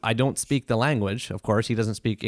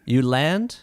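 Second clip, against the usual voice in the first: very slow, careful; 2 semitones higher, much slower than usual. Retold or imitated. Doctor in Japan advising a worried foreign patient, very slowly and carefully. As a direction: imitate slow, halting broken English. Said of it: "very, very slowly, very, very carefully".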